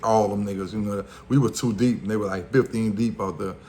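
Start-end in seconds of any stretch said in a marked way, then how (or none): none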